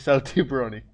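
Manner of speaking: ordinary delivery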